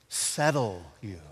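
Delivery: ordinary delivery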